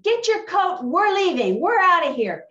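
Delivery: high, loud mad outburst; imitating angry yell. Angry coworker ordering her sister out, imitated yelling. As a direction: yell it like someone storming out. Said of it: "got really mad and she yelled".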